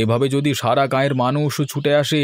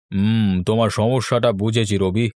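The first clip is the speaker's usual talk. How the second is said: lower elder's voice; 4 semitones lower than usual. Acted. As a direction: weigh the problem like an unhurried old landlord. Village elder Raymoshai speaks.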